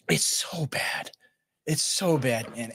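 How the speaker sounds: slow, near-whispered groan of dismay; hushed dismay. Real, not acted. Podcaster reacting to the guilt message, hushed dismay. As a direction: whisper it slowly, drawing out the words. Dismayed aside after mocking quote.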